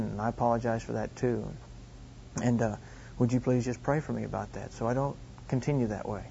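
low, soft, hesitant apology; lightly acted humble teenage admission; subdued confession. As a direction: confess it quietly to your dad. Teen son confessing and apologising to dad.

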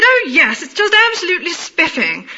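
high, mock upper-class London accent. Answering the caller about the weather, mock upper-class accent. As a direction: exaggerate the upper-class vowels, pitched high. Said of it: "I responded in kind".

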